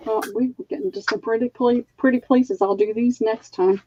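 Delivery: ordinary talk, nothing marked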